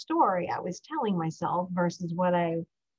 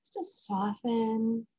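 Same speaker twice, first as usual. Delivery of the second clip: gentle mindfulness cue, unhurried; guided breathing. Acted. Leads a breathing exercise.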